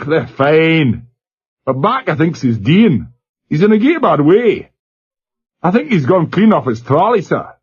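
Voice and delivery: broad Glasgow lad on a crackly line; Glasgow accent. A narrator's reading. Glasgow boy answering the captain over the speaker, Glasgow accent. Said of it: "slurred Glasgow accent".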